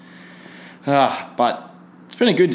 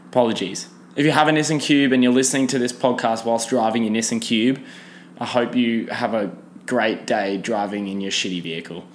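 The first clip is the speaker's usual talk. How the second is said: ordinary talk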